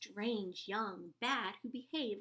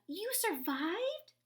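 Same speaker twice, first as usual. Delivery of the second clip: high, loud joyful cry; excited cry; 11 semitones higher, 6 dB louder, much slower than usual. A narrator's reading. Stellaluna cries out to her found mother, overjoyed. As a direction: cry out in happy disbelief. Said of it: "cried Stellaluna".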